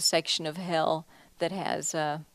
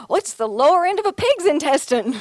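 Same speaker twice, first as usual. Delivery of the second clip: bright, chipper answer pitched high and louder; sounding positive; 10 semitones higher, 8 dB louder than usual. Retold or imitated. Artist cheerfully explaining bung to her gallerist, upbeat and positive. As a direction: keep it cheery and positive no matter what. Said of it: "So I just sounded positive".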